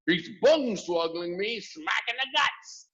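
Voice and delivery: high, shouted complaint. A narrator's reading. Second giant shouting about being kicked. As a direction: shout in pained outrage. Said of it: "shouted the gizzard gulper".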